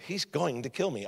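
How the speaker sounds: hushed mock dread; hushed inner thought. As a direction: voice a worried thought under your breath. Retold frightened thought in church.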